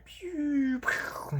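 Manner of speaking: high-pitched pew then a whispered pew; playful mouth sound effect of shots; mortar pew sounds. Retold or imitated. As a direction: mimic distant mortar fire with your mouth. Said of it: "It sounds like a mortar".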